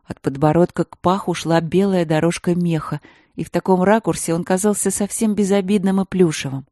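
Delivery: ordinary delivery, nothing marked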